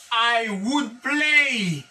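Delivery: very slow and deliberate classroom model; slow model reading. Shown as a demonstration. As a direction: let each word land for students to repeat. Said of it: "teacher models English slowly".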